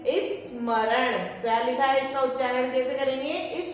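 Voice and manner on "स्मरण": स्मरण said very slowly, syllable by syllable, with a short i before the s; slow clear pronunciation. Shown as a demonstration. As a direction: spell out स्मरण slowly for the class. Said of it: "सबसे पहला शब्द ये आधा सा है".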